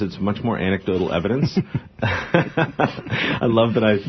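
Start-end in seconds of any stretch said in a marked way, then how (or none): 2.0-3.9 laughing. Real, not acted. Laughs about the bacon.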